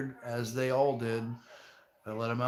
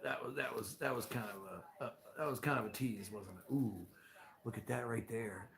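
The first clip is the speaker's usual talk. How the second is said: ordinary delivery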